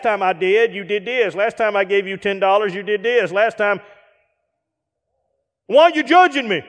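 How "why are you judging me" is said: defensive whine. Retold or imitated. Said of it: "friend turns defensive".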